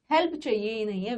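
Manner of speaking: ordinary talk, nothing marked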